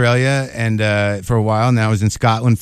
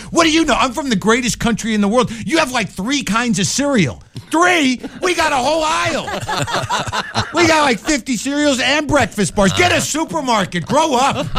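much higher, pompous bragging patriot; boastful American; 12 semitones higher than usual. Retold or imitated. Comic mocking himself bragging to the Scots, much higher. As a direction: brag like a cartoon flag-waver. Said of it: "saying stuff like this".

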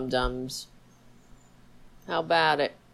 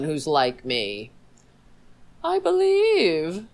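sarcastically voicing a self-righteous conservative, whining; mock self-pity; 4 semitones higher, 5 dB louder than usual. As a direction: play the aggrieved victim for laughs. Continues mocking self-pity.

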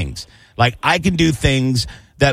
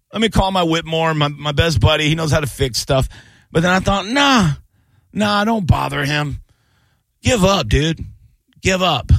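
whiny voice; 5 semitones higher than usual. Acted. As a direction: whine like you're giving up. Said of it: "I'm whining on purpose".

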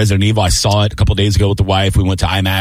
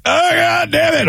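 high, drawn-out mock wail; retold grief outburst; slower than usual. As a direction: re-enact losing it in the car. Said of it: "I'm not crying uncontrollably anymore".

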